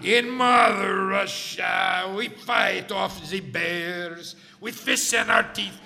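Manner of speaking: slow, booming Mother Russia speech; proud Russian boast. Acted. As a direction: declaim it like a proud patriot.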